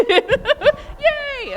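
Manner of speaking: mock squeal, high and loud; imitating Heidi's excitement. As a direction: play up Heidi's over-the-top joy. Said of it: "Almost as excited as Heidi gets".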